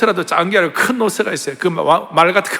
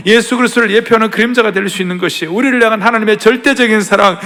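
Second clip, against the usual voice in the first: normal talking voice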